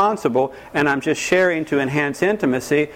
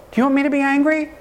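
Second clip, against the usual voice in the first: higher, mock-menacing question; mock threat; 8 semitones higher, faster than usual. Emotional terrorist lightly threatening the partner, mock menace. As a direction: dangle the threat with a sly lilt.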